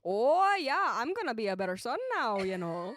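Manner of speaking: put-on a lightly done Minnesotan accent, sunny and chatty. Doing a Minnesotan accent for a podcast accent game. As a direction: lay on the Minnesota vowels, cheerful.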